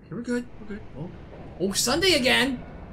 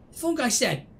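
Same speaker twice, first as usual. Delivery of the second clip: ordinary talk, nothing marked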